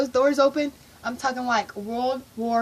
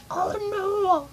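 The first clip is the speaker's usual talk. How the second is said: high, tearful mock wail; overwhelmed disbelief; 7 semitones higher than usual. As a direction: wail it high, as if about to cry. Overwhelmed by surprise tickets.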